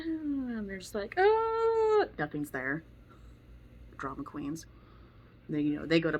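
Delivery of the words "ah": high, loud hammed-up "Ah"; mock kid shriek. The kid overplaying a harmless bite, loud high mock shriek. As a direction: squeal it like a drama queen kid. Kids reacting to a play bite.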